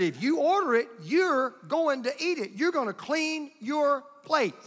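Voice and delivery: high, slow, word-by-word insistence; stern decree. Retold or imitated. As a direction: hammer each word home as the unyielding grandfather. Retold grandfather hammers his rule home.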